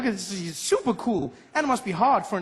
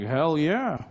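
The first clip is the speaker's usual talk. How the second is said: American drawl; 3 semitones lower than usual. Retold or imitated. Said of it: "he was like".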